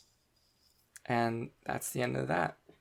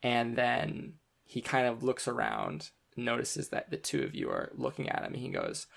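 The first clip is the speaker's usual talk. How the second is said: ordinary delivery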